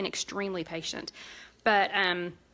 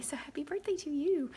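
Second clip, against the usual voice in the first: whisper; 7 semitones higher than usual. Real, not acted. Host whispering birthday wishes to a viewer. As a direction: whisper it like a sweet secret.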